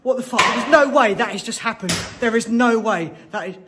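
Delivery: panic, nearly screaming, then still shaken; panicked shouting. Real, not acted. After something moves by itself, shouting in panic.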